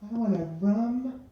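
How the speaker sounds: put-on high, slow girly voice ordering a fussy drink; imitating a little girl. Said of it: "a little girl who says".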